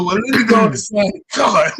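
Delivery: laughing through the punchline. Retold or imitated. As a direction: crack up while saying it. Punchline of card story.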